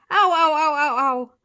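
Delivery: high, loud string of ows; shouted yelps of pain. A narrator's reading. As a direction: howl each ow in pain. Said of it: "shouted the giant slug".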